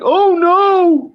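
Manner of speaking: high, drawn-out cry of dread; hammed-up horrified reaction; mock dismay. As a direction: stretch it into a comic wail of alarm. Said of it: "Sloan's face".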